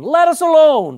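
loud, high demon cry; anguished howl at Jesus. Acted. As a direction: shout it like a cornered demon. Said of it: "And he cried out".

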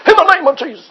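high, hammed-up holy-roller prayer voice; overdone faith-healer pronouncement; mock preacher blessing. Pastor mocking a showy blessing, in a high hammed-up voice. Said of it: "I don't mean come up to him and do like this".

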